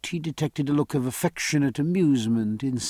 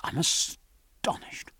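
slow astonished whisper; 6 dB quieter, slower than usual. Acted. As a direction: whisper it slowly, in quiet awe. Jack stunned seeing his son.

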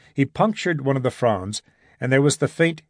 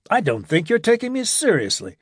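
bitter protest; 7 semitones higher than usual. A narrator's reading. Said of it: "Gibson protested bitterly".

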